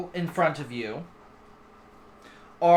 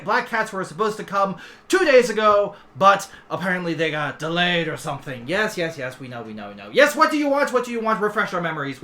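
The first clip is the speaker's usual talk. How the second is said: chattering two-headed dragon; 3 semitones higher, 6 dB louder than usual. Acted. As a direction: babble self-importantly, repeats spilling over each other. Voices two bickering dragon heads.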